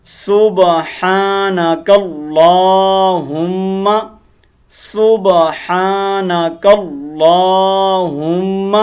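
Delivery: سبحانک اللہم drawn out very slowly, ha from the throat, hum held long; slow correct recitation. Shown as a demonstration. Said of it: "تلفظی غلطیاں set کرتے ہیں". (سبحانک اللہم کو بہت آہستہ، حلق سے ہا نکال کر پڑھیں)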